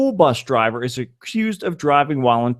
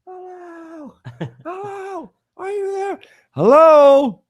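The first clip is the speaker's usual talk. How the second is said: shouting into a phone; 9 semitones higher than usual. Pretend. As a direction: shout hello again and again into the phone. Pretending the phone call dropped.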